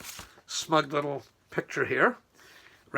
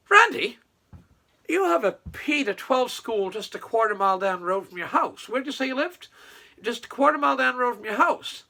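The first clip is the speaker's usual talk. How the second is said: incredulous reply; 7 semitones higher than usual. Acted. Acts out the official's incredulous reply.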